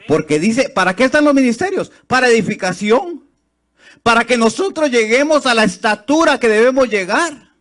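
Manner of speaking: high, loud preaching cry; fervent loud preaching; full-force preaching. Acted. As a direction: preach it out to the whole church.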